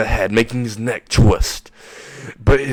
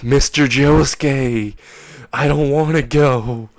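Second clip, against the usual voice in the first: loud, slow, higher mock wail; melodramatic pleading; 4 semitones higher, 4 dB louder, slower than usual. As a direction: whine it out as you fade away. Fading character pleads dramatically.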